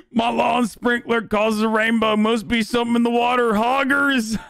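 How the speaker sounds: hammed-up yokel suspicion; conspiracy yokel. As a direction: play a ranting backwoods conspiracy believer.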